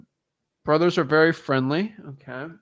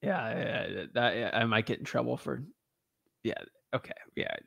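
ordinary delivery

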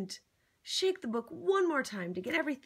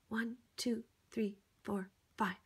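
whispered counting; 5 dB quieter than usual. Acted. Guiding a child through the taps, whispering.

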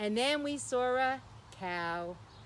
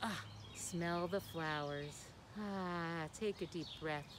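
soft slow breathing guidance; calm breathing cue; 6 semitones lower, 6 dB quieter, slower than usual. Real, not acted. Children's host guiding kids through a calm breath. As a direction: guide a deep calm breath. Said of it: "Let's smell 'em".

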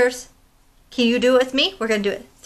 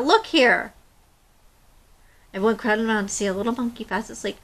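put-on expressive read-aloud to children; storybook reading; 4 dB louder than usual. Teacher reading a picture book to young listeners, expressive. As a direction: tell the tale to a class of kids.

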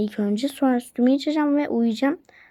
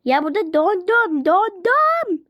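high, louder wail that she's frozen, climbing on the repeat; freezing complaint; 7 semitones higher, 3 dB louder, slower than usual. Real, not acted. Coming in from the cold to her housemate, high loud squealing complaint. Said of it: "Sakin ol".

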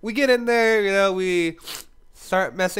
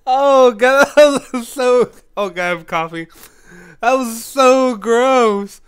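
loud, disgusted laughter, slowing down; laughing in disgust; 5 semitones higher, 7 dB louder, slower than usual. Real, not acted. Retelling a gross shower moment to viewers, laughing loudly. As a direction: crack up while groaning at the memory. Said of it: "I was laughing".

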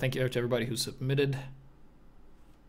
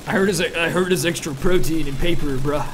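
loud joking; 3 semitones higher, 10 dB louder than usual. Pretend. Joke about eating paper.

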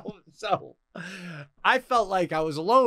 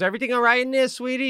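higher, sugary nurse over the intercom; sweet nurse voice; 7 semitones higher, faster than usual. Retold or imitated. Imitating the nurse calling him sweetie, higher and sugary. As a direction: imitate the tech checking in sweetly. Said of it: "in speakers and goes".

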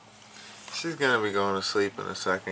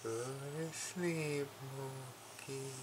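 light soft voice; 2 semitones higher, 14 dB quieter, much slower than usual. Real, not acted. Telling Mookie the cat to sleep, hushed and slow. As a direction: lull the cat to sleep softly. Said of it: "in that light voice".

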